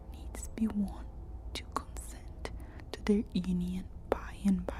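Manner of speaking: plain reading voice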